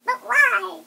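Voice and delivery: put-on high, loud hurt girlfriend voice; upset girlfriend. Girlfriend to boyfriend after a breakup, high loud upset voice.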